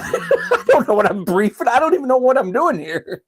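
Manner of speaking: laughing at how lost he was. Real, not acted. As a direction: talk through the giggles. Laughing at own confusion.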